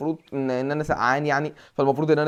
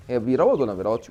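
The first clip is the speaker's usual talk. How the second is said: ordinary delivery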